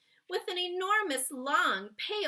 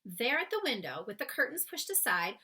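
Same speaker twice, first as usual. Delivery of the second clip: plain reading voice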